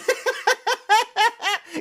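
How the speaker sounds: high-pitched, loud burst of laughter; real high giggling laugh; laughing fit. Real, not acted. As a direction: crack up helplessly at your own story. Cracks up at his own story.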